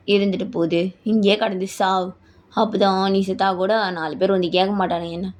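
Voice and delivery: low, dismissive male voice; callous husband. Acted. Husband telling wife to die here, callous.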